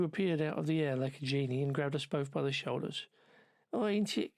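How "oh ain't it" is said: high-pitched, botched Scouse; attempted Scouse accent. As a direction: have a shaky go at mock Scouse. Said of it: "I can't do a scouse accent".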